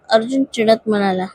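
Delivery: plain reading voice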